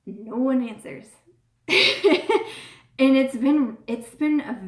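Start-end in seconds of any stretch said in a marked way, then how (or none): 1.7-2.7 laugh. Real, not acted. Laughing at book community.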